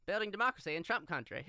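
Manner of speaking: put-on a light touch of an old-time announcer; 1940s announcer voice. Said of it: "nineteen forties announcer voice".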